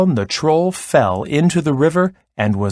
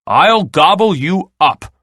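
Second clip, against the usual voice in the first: louder, high-pitched threat; troll shout; 7 semitones higher, 5 dB louder than usual. A narrator's reading. Troll threatening the middle goat, raised shout. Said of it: "shouted the troll".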